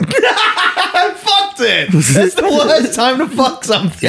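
laughing through the words. Real, not acted. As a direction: crack up mid-sentence. Laughing at own blunder.

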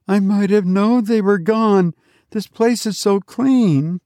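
put-on high-pitched child's voice; realising boy. A narrator's reading. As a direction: speak high, like a boy who has just understood. Boy realises parents are gone.

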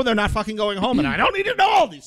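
high, loud fed-up burst; rising rant. Real, not acted. Ranting to co-hosts about spoiler trailers, loud exasperated burst.

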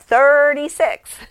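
high, loud, drawn-out answer; excited child. Retold or imitated. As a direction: blurt it out like an eager toddler. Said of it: "the preschooler popped up".

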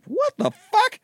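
high, incredulous what the fuck; shocked reaction. Retold or imitated. Host reacting to the movie's line, shocked high. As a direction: squeal it in disbelief. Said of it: "I was like".

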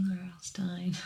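breathy loving whisper. Real, not acted. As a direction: whisper it like a secret. Whispered fan love.

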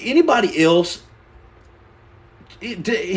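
usual speaking voice

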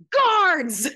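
high, loud imperious shout; mock-ducal bellow; calling for guards. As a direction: summon the guards across the hall. [name] calls for guards.

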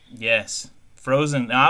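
ordinary talk

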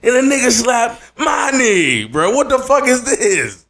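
high, loud incredulous retelling; worked-up storyteller; indignant. Real, not acted. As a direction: rant in disbelief about the knee slap. Indignant retelling of knee slap.

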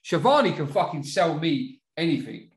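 ordinary delivery

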